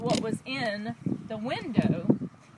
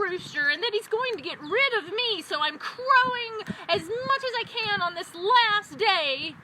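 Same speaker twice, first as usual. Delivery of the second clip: high, excited storybook rooster; agitated rooster; 10 semitones higher, 4 dB louder than usual. A narrator's reading. Rooster explains his crowing to the donkey, high and agitated. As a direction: pipe up high, loud opening cry. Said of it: "The rooster said".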